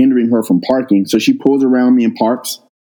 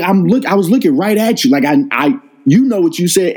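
higher pitched, pressing the point; exasperated insistence; 8 semitones higher than usual. Retold or imitated. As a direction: insist she knows what she said. She denies saying the slur.

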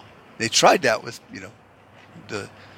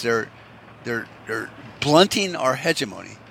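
usual speaking voice

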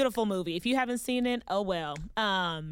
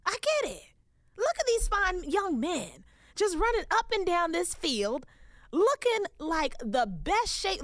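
higher, dreamy gushing over the players; swooning admiration; 6 semitones higher than usual. Retold or imitated. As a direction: gush like you just saw the light. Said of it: "I was like".

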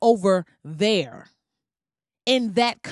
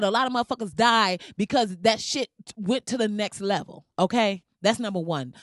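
ordinary delivery

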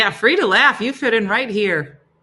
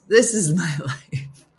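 laughing; 5 dB louder, slower than usual. Real, not acted. Laughing at her own misery.

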